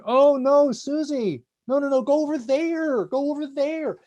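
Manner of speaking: high anxious parent voice calling no, no, no; fretting parent. Acted. Stanford professor voicing parents behind the mirror: high fretting plea.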